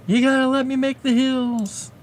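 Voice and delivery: high, pleading coo. Real, not acted. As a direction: sweetly beg the pet to let you work. Pleading with pet on lap.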